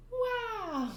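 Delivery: high, sing-song magical wow; a twinkly fairy tale wow. Retold or imitated. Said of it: "and was like".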